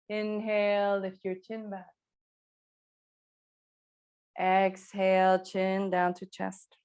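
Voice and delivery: slow, stretched instruction; drawn-out movement cue. Real, not acted. Yoga teacher guiding chin to chest on exhale, drawn out.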